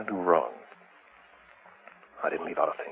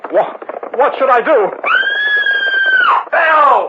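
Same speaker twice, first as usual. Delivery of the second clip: much higher and louder panicked cry; terrified outburst; frightened shout; 10 dB louder, slower than usual. Acted. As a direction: shout in sudden alarm. Shocked, then shouts for wife.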